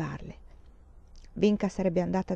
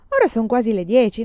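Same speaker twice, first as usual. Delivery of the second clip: ordinary delivery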